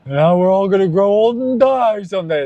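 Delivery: high, mock-cheery moping voice; sing-song gloom like a sad party host; lightly voiced gloomy cheer from UHF; quoting the movie. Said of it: "That's also from U H F".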